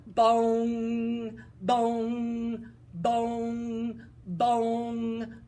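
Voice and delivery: loud mock clock chime; clock bong. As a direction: ring out each bong. Clock striking twelve.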